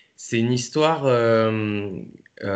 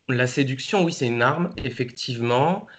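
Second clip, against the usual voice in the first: ordinary talk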